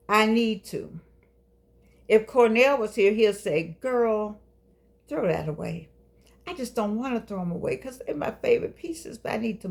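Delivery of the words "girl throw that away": ordinary delivery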